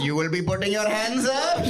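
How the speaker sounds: put-on gangster threat in an Indian accent. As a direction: menace someone politely in an Indian accent. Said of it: "imagine that accent being gangster".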